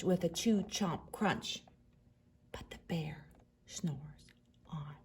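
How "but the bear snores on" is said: much softer hushed refrain. A narrator's reading. Reading to children, hushing the sleeping bear. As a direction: barely breathe it out.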